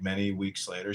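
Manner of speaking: normal talking voice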